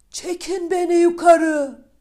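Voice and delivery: high, loud panicked shout. Acted. Eldest son down the well shouting to his brothers in panic. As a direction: yell up the well to be pulled out. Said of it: "diye bağırmış".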